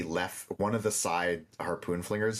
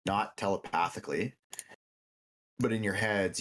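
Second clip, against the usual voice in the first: ordinary delivery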